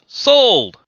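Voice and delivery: loud, high auctioneer's call; booming auctioneer cry; auctioneer shout. Acted. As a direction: bang the gavel and call it out. Auctioneer calls out sale.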